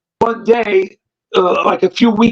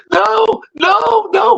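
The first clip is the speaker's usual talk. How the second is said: high pleading no no no; mock pleading; 14 semitones higher, much slower than usual. Beaten man pleading as Jimmy punches him, high and pleading.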